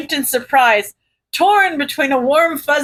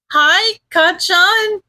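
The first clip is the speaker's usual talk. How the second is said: slow, sheepish and higher; 7 semitones higher, much slower than usual. Acted. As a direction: greet him sheepishly, slowly. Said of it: "Izuku smiled sheepishly".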